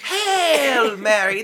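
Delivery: very high, loud solemn hail; grand proclamation. Retold or imitated. Nun showing how Gabriel hailed Mary, high and loud. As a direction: proclaim it as the angel, in the nun's voice. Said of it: "No, he said".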